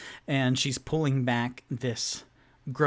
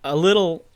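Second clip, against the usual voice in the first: louder squeaky creature noise; creature chatter; 5 semitones higher, 9 dB louder, faster than usual. Acted. GM voices the rat creature's chattering noise. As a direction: chitter like a rat thing.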